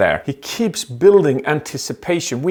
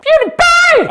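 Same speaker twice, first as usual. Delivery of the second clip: very high, loud cry; excited shout; 7 dB louder, slower than usual. Real, not acted. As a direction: shriek the name. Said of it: "excited shout of name".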